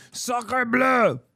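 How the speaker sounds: high hammed-up French accent exclamation; french chef.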